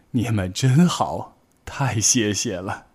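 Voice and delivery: slow, weary grateful grandma; tired old bear. Acted. Grandma Bear to the rabbits, slow and weary thanks. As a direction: thank them as sleep takes over.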